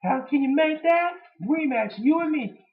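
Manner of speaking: high sarcastic wrestler impression; sarcastic Punk mockery. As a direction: sneer it in a squeaky mock voice. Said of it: "he was saying".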